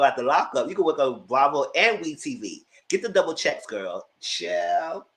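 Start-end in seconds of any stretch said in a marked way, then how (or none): none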